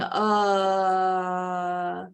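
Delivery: long, droning monotone "uhhh"; drawn-out "uh". Shown as a demonstration. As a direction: hold a low filler "uh" on one note. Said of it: "without going".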